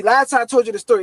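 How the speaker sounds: ordinary delivery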